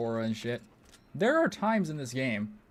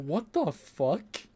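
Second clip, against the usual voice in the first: slow, drawn-out disbelief, pitched up; baffled what the fuck; 3 semitones higher, much slower than usual. Retold or imitated. Streamer reacting to absurd bot abilities, drawn-out disbelief. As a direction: stretch it out, honestly baffled.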